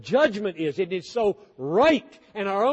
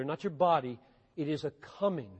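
normal talking voice

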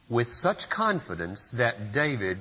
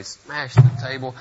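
building fury; 2 semitones higher, 11 dB louder than usual. A narrator's reading. Narrating Luther's outburst.

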